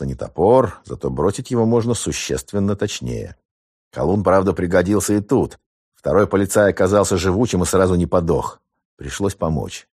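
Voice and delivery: usual speaking voice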